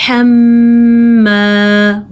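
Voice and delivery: حَمَّا drawn out with long nasal ghunna on the doubled م; Quran recitation. Shown as a demonstration. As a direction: slowly recite حَمَّا, holding the م in the nose. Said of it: "غنہ".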